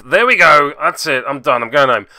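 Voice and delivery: loud, hammed-up giving up; loud mock huff of defeat; mock exasperation. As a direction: throw in the towel in jest. Mock quitting after losing roll.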